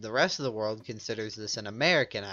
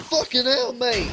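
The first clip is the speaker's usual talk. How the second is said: startled high, loud yelp in fake English-Australian accent; 12 semitones higher, 11 dB louder than usual. Real, not acted. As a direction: yell it in shock, fake Aussie-English accent. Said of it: "My fake accent slipped through".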